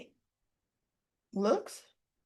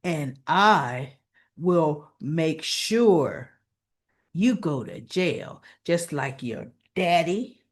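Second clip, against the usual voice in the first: low, slow threatening voice; hammed-up low villain menace; menacing villain; 7 semitones lower, slower than usual. Drew threatening the boys.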